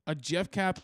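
normal talking voice

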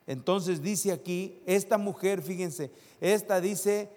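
loud, slow preaching, stretching each word; preaching at full force. Acted. Pastor reading the verse to the church, slow and forceful. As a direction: pound out the verse slowly.

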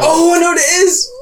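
high, excited outburst; excited realisation. Real, not acted. Contestant suddenly gets the film from a clue, excited. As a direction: blurt it out as the answer hits you.

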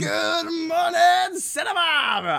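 high, slow, shouted DJ greeting; radio DJ intro. Acted. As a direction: yell it like a morning radio host.

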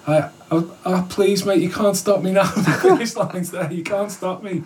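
high, pleading, half-laughing; desperate plea. Retold or imitated. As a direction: beg him to let you finish. Pleading with the marshal.

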